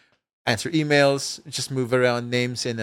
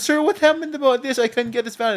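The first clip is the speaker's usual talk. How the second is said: student voice; 9 semitones higher than usual. Retold or imitated. Student quoted in story.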